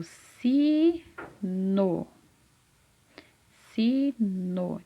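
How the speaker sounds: SINO very slowly, SI held long, then NO; split into syllables. Shown as a demonstration. Teacher revealing the answer to a child, "sino" drawn out by syllables. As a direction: stretch "sino" into si... no. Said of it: "que é a sílaba si".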